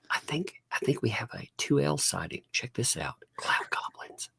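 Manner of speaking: hushed nature-guide whisper. Pretend. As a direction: breathe it like spotting rare wildlife. Pointing out creatures in whisper.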